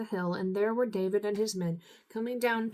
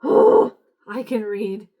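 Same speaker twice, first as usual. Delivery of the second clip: loud, slow, drawn-out frustrated sigh of a protest; exasperated outburst; 15 dB louder, much slower than usual. Real, not acted. Tired reader scolding herself after misreading, exasperated and loud. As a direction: snap at yourself after one too many slips. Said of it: "I'm tired, okay?".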